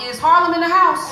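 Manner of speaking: loud, high crowd call; rally the audience. Real, not acted.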